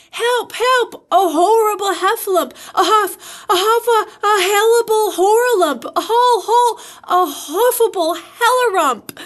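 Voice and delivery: high, loud, panicked garbling of words; Piglet crying out. A narrator's reading. Piglet scampers off from the Heffalump, frantic cries. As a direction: squeal in terror, tripping over the words. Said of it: "still crying out".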